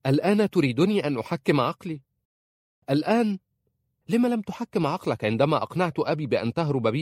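incredulous shouted reproach; crying out. A narrator's reading. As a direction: fire off outraged questions at a high pitch. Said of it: "صاحت".

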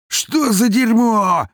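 high, slow furious howl; howling shout. Acted. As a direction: howl it out in rage. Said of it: "взвыл убийца".

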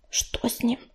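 hushed, whispered aside; quiet question. A narrator's reading. Листвичка asks her mother about father, in a hush. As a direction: ask under your breath. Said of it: "тихонько спросила она".

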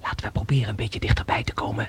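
whispered, creeping closer unseen. Acted. Explorer whispering to friend while hiding near the cave, whisper. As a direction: breathe it so nobody hears.

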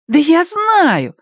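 put-on high, slow, exasperated child; annoyed. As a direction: sigh it out, annoyed. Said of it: "досадливо сказал Бенни".

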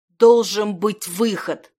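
loud, drawn-out cry; slow, loud desperate protest; desperate wail. A narrator's reading. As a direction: cry out in rage and despair. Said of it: "голосил я".

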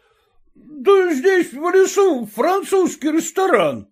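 high, slow merry shout. A narrator's reading. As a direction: call it out tipsy and delighted. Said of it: "кричал".

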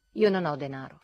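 curt. A narrator's reading. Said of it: "fece tagliando corto".

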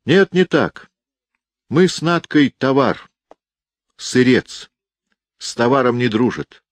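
put-on high, loud boy's voice; a defiant little boy speaking up; child voice. Boy tells aunt Liza, child voice.

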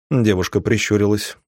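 plain reading voice

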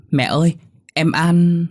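put-on high, loud, drawn-out child's call. Daughter points mother to doll at window, calling out. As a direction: stretch the words in a small girl's high voice.

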